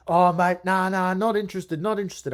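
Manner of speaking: high, loud brush-off; dismissive tradesman. Retold or imitated. Owner refuses the cold caller, high, loud brush-off. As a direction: shut the caller down fast and high. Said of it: "I'm gonna do an impersonation".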